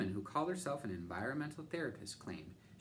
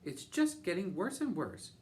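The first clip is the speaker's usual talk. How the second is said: despairing; 9 semitones higher, 6 dB louder than usual. A narrator's reading. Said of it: "She began to sob".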